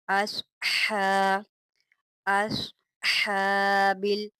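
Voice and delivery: हा held about a second, slowly; long ha. Shown as a demonstration. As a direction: stretch हा for one count. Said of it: "एक अलिफ के बराबर लंबा खींच के".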